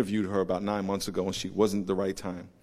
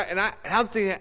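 usual speaking voice

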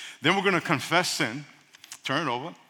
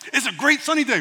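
mock cheerful; 7 semitones higher, 4 dB louder than usual. Imitating a cheerful speaker.

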